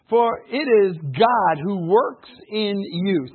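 every word slow and weighty; slow emphatic reading. Shown as a demonstration. Preacher reading the verse to his church, slow and emphatic. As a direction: lay each word down slowly. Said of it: "reading scripture slowly".

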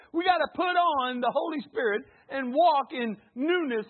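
high, loud, driving sermon; preaching at full force. Acted. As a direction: keep hammering it to the congregation.

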